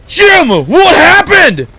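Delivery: high, loud gasp and slow shout; hammed-up alarmed father; shocked shout. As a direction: gasp then yell at your son. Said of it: "He's like".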